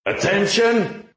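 rasping bullhorn bark, high and drawn out; through a megaphone. Acted. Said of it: "he barked in a rasping voice".

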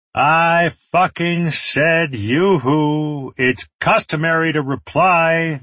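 high, drawn-out yell. A narrator's reading. Enemy demands an answer from the trapped group, yelling. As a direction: yell it slowly, stretching the words. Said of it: "the voice yelled".

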